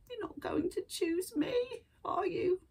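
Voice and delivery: high, tearful plea from the rat; sadly. Acted. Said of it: "it said sadly".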